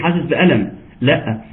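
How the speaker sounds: ordinary delivery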